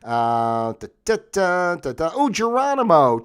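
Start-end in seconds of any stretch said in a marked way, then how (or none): 2.2-3.2 high, louder burst of recognition; pleased outburst; excited exclamation. Real, not acted. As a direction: blurt it out on spotting the name. Spots a famous name.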